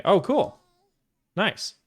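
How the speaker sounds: oh cool, jumping high with a rising lift; light up at the news; pleasantly surprised. Real, not acted. Streamer reacting to a viewer from the UK, delighted surprise.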